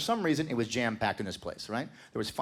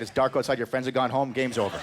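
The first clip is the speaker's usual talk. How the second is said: ordinary talk, nothing marked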